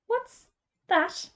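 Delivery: ordinary delivery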